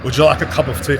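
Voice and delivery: badly faked British accent; British accent attempt. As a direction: have a failed go at a British accent. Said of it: "I can't even do it".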